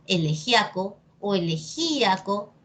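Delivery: ele-GIA-co, then ele-GÍ-a-co; two accepted stresses. Shown as a demonstration. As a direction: elegiaco on A, elegíaco on Í. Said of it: "depende de ti cómo lo quieras decir".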